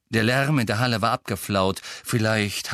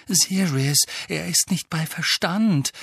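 high, earnest entreaty; pleading; 9 semitones higher than usual. A narrator's reading. Girl begging the master for understanding, pleading. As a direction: plead on the elf's behalf, voice lifted. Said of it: "flehte Hermine".

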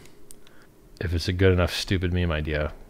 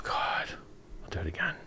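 frustrated whisper; 2 semitones lower, 13 dB quieter than usual. Real, not acted. Fumbling the numbers.

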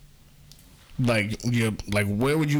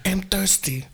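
mock sympathetic partner; 5 semitones higher than usual. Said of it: "say that I too understand".

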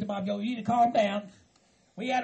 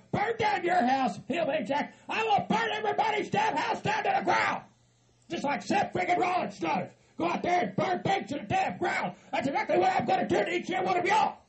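high, shouted threats; riled up; 7 semitones higher than usual. Acted. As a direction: hurl wild threats in a raised pitch. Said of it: "You're getting all riled up".

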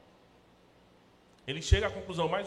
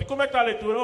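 pastor asking; 10 semitones higher, 10 dB louder, much faster than usual. Retold or imitated. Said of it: "eu já pergunto".

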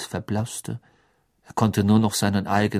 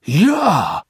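blurted out; 6 semitones higher, 8 dB louder than usual. A narrator's reading. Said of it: "stieß Karkaroff hervor".

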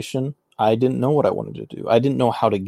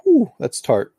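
surprised at the taste; 9 semitones higher, slower than usual. Real, not acted. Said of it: "that is some dark chocolate".

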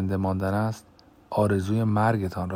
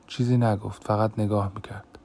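ordinary delivery

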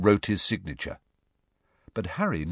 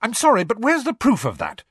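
very high, loud exasperated challenge; snapped; 14 semitones higher, 5 dB louder than usual. A narrator's reading. Schoolgirl challenging a believer, snapped. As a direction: snap it, demanding evidence. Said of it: "snapped Hermione".

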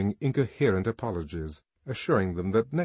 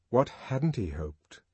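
ordinary delivery, nothing marked

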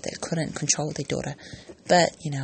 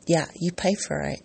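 ordinary talk

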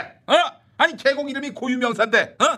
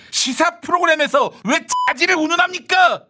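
outraged; 8 semitones higher, 4 dB louder than usual. Acted. Outraged host scolding.

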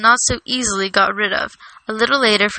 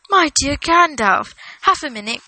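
a touch of flustered greeting, settling quickly; crying out; 5 semitones higher than usual. A narrator's reading. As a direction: call out in surprised welcome. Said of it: "cried Frodo".

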